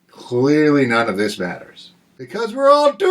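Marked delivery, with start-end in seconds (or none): 2.2-3.1 high panicked cry of doom; panicked screaming. Acted. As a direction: shriek it like the end is here. Comic doom ending.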